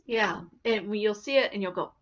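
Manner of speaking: ordinary delivery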